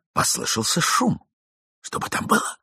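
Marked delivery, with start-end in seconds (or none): 1.8-2.6 a frightened little whisper; young man whispering in the dark. A narrator's reading. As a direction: whisper it from under the bed. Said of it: "прошептал молодой человек".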